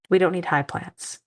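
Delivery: normal talking voice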